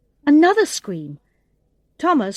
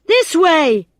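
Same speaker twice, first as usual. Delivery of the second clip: loud, higher shout; 7 semitones higher, 9 dB louder than usual. A narrator's reading. Thomas shouts directions to his friends, loud. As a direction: call them after you. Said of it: "shouted Thomas".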